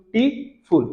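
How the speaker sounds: টি, ফুল split by a pause; syllable by syllable. Shown as a demonstration. Teacher counts beautiful's syllables for class, syllable by syllable. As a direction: break it into টি, then ফুল. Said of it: "এখানে তিনটা syllabus আছে না".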